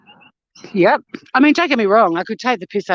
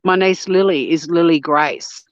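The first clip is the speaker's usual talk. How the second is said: ordinary talk, nothing marked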